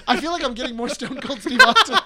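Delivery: laughing, high and loud; amused. Real, not acted. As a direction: crack up at your own bad impression. Breaks up at own impression.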